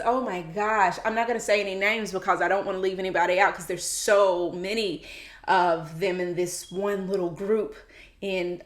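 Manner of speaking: usual speaking voice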